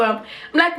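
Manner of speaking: ordinary delivery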